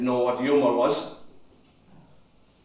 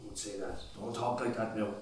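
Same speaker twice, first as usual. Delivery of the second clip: much softer, near-whispered; hushed plea; 6 semitones lower, 16 dB quieter than usual. Acted. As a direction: soothe him gently. Son comforting dying father.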